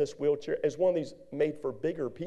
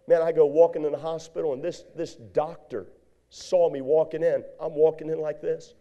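ordinary talk, nothing marked

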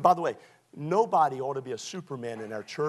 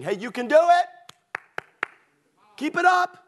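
higher and louder, cheering a tired friend on; cheering geese; 12 semitones higher, 6 dB louder than usual. Retold or imitated. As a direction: yell encouragement from the back of the flock. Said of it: "that's saying".

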